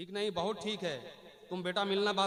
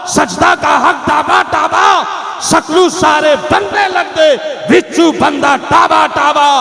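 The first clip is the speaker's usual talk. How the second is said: very loud, higher-pitched declamation; full-force oratory; 5 semitones higher, 27 dB louder, slower than usual. Real, not acted. Preacher addressing the educated youth and scholars of Kolkata, full-force oratory. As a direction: thunder each phrase out to the crowd.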